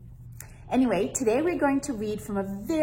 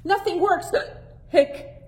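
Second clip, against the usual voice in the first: put-on frustrated hiccuping boy; 10 semitones higher, 4 dB louder than usual. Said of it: "Hector said".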